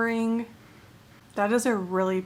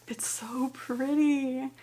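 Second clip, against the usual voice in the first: slow, hushed real amazement; awestruck; 3 semitones higher, 3 dB quieter, much slower than usual. Real, not acted. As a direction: draw it out in breath-taken wonder. Opening a gifted book, awestruck.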